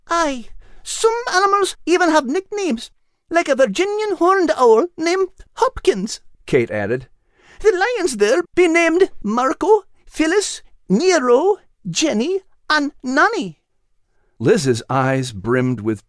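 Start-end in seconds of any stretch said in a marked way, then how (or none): 0.1-6.2 put-on high, eager girl's voice rattling off a list; a thrilled little girl listing every animal; excited young girl character. Kate explains. 7.6-13.6 put-on high, slow girl's voice naming the lions; young girl character. Kate lists lion names.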